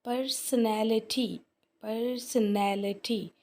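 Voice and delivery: Personality drawn out slowly in separate syllables. Shown as a demonstration. Teacher showing syllables to class, personality slowly broken up. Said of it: "कितने इसके syllables बन रहे हैं".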